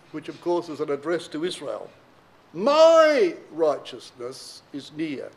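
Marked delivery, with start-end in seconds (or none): 2.5-5.3 "My" leaned on hard, the rest slow and loud; slow preaching stress on "My". Shown as a demonstration. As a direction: hit the word "My" and draw the line out slowly. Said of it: "Emphasis on the word "my".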